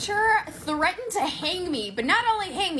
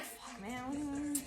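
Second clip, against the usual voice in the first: under-breath curse; 13 dB quieter than usual. Retold or imitated. Kid's reply to teacher's call.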